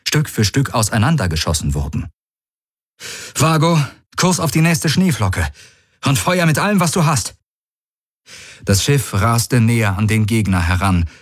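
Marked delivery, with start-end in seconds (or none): 3.3-7.3 high-pitched, pressing command; urgent battle order. A narrator's reading. Galen ordering his ship to attack, urgent command.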